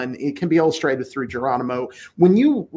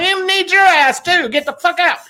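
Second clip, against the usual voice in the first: defiant Tonto; 13 semitones higher, 4 dB louder than usual. Pretend. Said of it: "And he's like".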